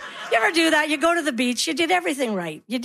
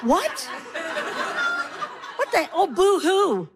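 high, scornful mother's disbelief; mock indignation; 6 semitones higher, slower than usual. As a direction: scoff at the child's complaint, pitched up. Mother scoffs at child's complaint.

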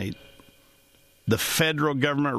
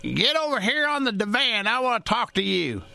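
high calling granny voice; a hint of a grandmother calling across the room; 9 semitones higher than usual. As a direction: call the kid over like grandma. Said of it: "My grandmother used to say to me".

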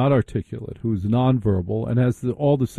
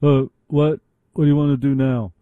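louder, higher mock radio-person voice; radio people; 3 semitones higher, 3 dB louder than usual. Imitating how fellow radio people talk to each other, mock radio voice. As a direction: put on a radio person's way of talking. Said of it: "radio people talk like this".

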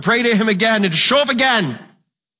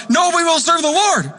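the people protesting; 10 semitones higher than usual. Retold or imitated. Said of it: "And the people said to Joshua".

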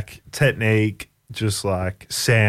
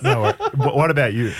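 laughing; 5 semitones higher, faster than usual. Real, not acted. Laughing while asking back.

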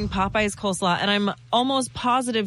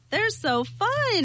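higher, slow gush of delight; 11 semitones higher, much slower than usual. Real, not acted. Guest gushing to host about pony races, high and slow. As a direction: savor every word of how fun it is.

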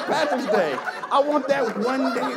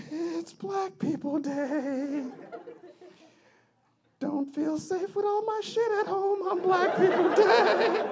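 much higher, slow, nervous mock office-worker voice; scared office worker; 9 semitones higher, 4 dB quieter, slower than usual. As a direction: play a jittery cubicle worker, laughing.

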